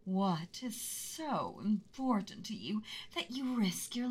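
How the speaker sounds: menacing, hushed dragon threat, drawn out; mean angry dragon voice. Acted. Dragon to a trespassing boy, menacing hush. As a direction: loom over him like a beast in its lair. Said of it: "mean, angry dragon voice".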